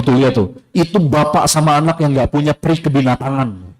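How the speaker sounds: normal talking voice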